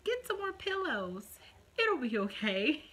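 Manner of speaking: high, singsong fake reassurance; mimicking doctor's breezy comfort; mock doctor advice. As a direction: parrot a dismissive doctor. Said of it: "That's what the doctors tell you".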